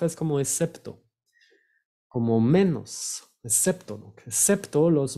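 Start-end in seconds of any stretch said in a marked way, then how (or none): none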